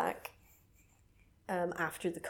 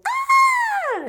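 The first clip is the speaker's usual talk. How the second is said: long, high, loud scream; big full-voiced demo scream; mock scream; 12 dB louder than usual. Shown as a demonstration. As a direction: let out a piercing drawn-out "Ah". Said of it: "But she doesn't go".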